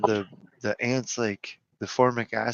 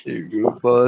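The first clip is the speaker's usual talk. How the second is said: normal talking voice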